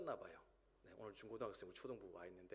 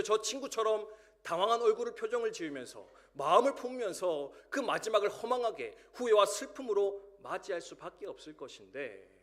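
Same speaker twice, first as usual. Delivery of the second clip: raised, louder pulpit voice driving the warning home; impassioned sermon delivery, louder and higher; full-force preaching; 7 semitones higher, 8 dB louder than usual. Acted. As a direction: preach it out loud to the congregation. Preaching climax.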